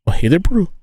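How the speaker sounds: put-on playful New Zealand accent; mock Kiwi accent. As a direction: imitate a Kiwi greeting a mate. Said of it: "you guys say bro".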